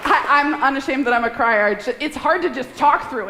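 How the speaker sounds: high, teary voice fighting back tears; tearful. Real, not acted. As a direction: talk through real tears, trying to hold it together. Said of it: "I didn't know I'd start crying this fast".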